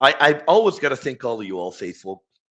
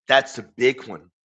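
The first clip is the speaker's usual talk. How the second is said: ordinary talk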